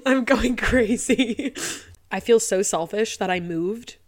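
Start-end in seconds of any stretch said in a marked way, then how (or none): 0.1-1.5 drawn-out, higher teary wail; tearful exasperation. Real, not acted. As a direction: slowly lament through real tears. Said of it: "I can't keep crying on the mic".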